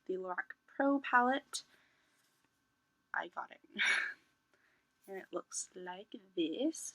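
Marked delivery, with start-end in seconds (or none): 3.1-6.9 whisper. Real, not acted. Laughing reveal of palette.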